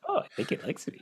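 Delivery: breathy whispered, high and soft; a soft, puzzled whisper; bemused whisper. Pretend. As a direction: murmur it in mock wonder. Reading the haiku aloud, bemused.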